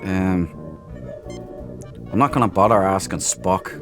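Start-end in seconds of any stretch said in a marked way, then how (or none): none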